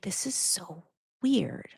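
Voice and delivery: hushed, slow whisper to herself; whispered aside. Retold or imitated. Narrator recalling her own stunned reaction, whispered slowly. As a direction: breathe it out in disbelief.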